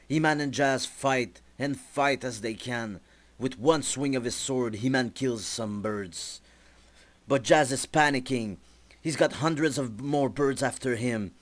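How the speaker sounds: ordinary delivery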